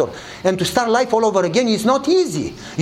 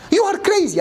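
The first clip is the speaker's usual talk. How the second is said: high, amazed verdict; incredulous officer; 9 semitones higher than usual. Retold or imitated. Officer judging the pastor who gave up money, amazed. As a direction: blurt it out in amazement.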